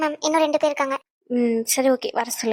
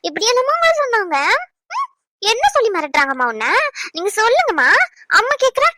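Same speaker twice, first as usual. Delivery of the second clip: put-on baby talk; 5 semitones higher than usual. Mother coos to baby.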